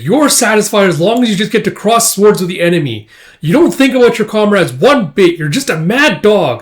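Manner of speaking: high, loud accusing tirade; angry berating. Acted. Casca berates Guts about his comrades, angry and loud.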